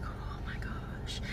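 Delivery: whispered oh my gosh, slow and breathless; slow shocked whisper; hushed gasp. Retold or imitated. Classmates react to shooting video.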